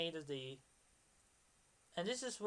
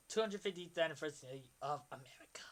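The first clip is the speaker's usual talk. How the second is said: fast, breathy whispered aside; rushed whisper; 4 semitones lower, 5 dB quieter, faster than usual. Real, not acted. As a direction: whisper it quickly to yourself.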